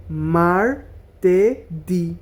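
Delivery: slow broken syllables; মার্তেদি split into slow syllables. Shown as a demonstration. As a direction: say মার্তেদি as mar-te-di, slowly. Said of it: "এভাবে syllable ভাঙা ভাঙা".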